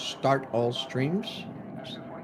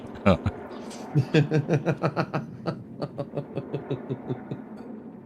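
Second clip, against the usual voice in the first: wheezing laugh. Real, not acted. Laughing at the singing.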